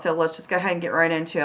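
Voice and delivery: rattled off quickly; fast. Real, not acted. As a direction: rush through the phrase. Same phrase rushed the second time.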